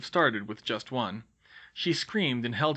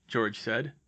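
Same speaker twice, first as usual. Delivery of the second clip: plain reading voice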